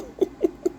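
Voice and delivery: quick squeaky laugh; a short high yelp of a laugh; sharp high laugh. Real, not acted. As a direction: laugh at the repeat message. Laughing at a new message.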